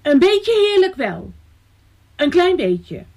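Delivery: put-on high-pitched, timid little girl; putting a brave face on fright; frightened little voice. A narrator's reading. Elsje admits it's only a little lovely, frightened little voice. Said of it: "met een bang stemmetje".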